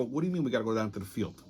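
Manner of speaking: low, soft, rapid questioning; baffled reply. Retold or imitated. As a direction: retell your puzzlement under the voice. Confused reply to the colleague.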